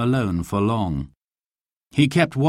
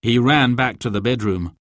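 usual speaking voice

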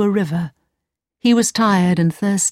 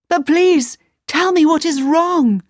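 pleading; 9 semitones higher than usual. Acted. Aladdin pleads with Sultan.

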